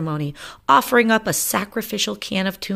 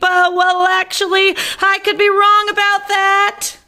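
nervous backpedal; 7 dB louder than usual. Acted.

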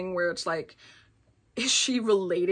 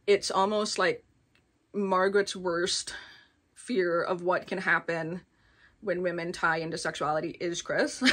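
normal talking voice

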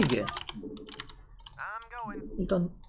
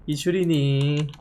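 drawn-out teasing question; slow mock question; 3 semitones lower, much slower than usual. Real, not acted. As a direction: stretch it out slowly, teasing. Mocking slow repeat of question.

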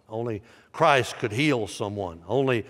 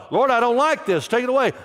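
high, loud begging cry; pleading with God; 11 semitones higher, 5 dB louder, faster than usual. Acted. Paul begging the Lord to remove the thorn, high and loud. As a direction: beg the Lord to take it away.

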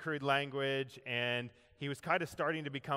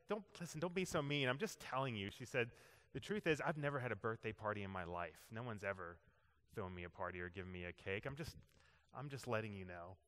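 soft and hurt; 4 semitones lower, 8 dB quieter, faster than usual. Retold or imitated. Agnes answers softly, hurt.

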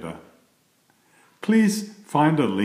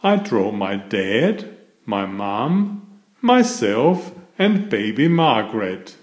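cheerful bedtime story reading; reading to children; 4 dB louder than usual. A narrator's reading. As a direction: read it like a picture book to little kids. Reads picture book to children.

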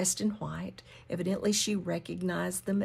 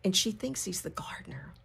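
normal talking voice

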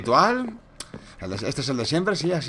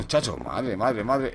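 usual speaking voice

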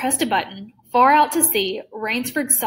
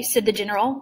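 usual speaking voice

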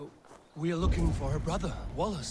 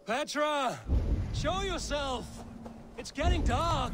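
calling out; 9 semitones higher, slower than usual. Acted. Calling out for lost Petra.

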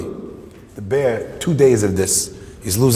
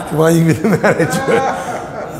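laughing through the words; real laughter breaking up the line; helpless laughing; 4 semitones higher than usual. Real, not acted. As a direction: repeat the punchline while cracking up. Laughing as he repeats the punchline.

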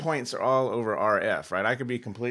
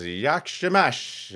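put-on slow, drawn-out Borat accent, pitched up; 5 semitones higher, 6 dB louder, much slower than usual. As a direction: stretch "Yakshamash" out in a hammed-up Kazakh Borat accent. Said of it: "I wouldn't do the accent".

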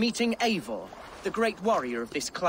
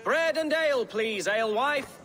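raised, higher call across the tavern; 6 semitones higher than usual. Acted. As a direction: call out to the alewife over the room. Calling to the alewife for service.

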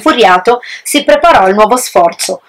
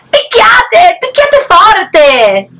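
much higher shout, slowing on forte; shouted order; 11 semitones higher, much slower than usual. Acted. Wise pig shouting at his brothers to beat the wolf. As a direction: urge them to strike hard. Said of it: "il porcellino saggio che lo aspettava ordinò".

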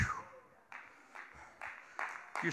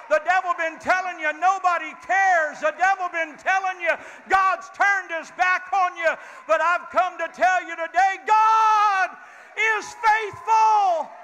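preaching build; 5 semitones higher than usual. Acted. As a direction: build the fire up to God is faithful.

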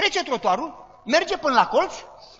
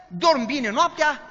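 usual speaking voice

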